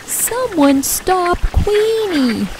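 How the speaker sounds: high, slow, drawn-out call; small quail calling for help; crying out for help. A narrator's reading. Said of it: "cries Quincy".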